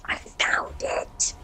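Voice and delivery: slow, drawn-out whisper; whispered relief at finding the earbud. Real, not acted. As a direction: breathe it out slowly in relief. Finds lost earbud quietly.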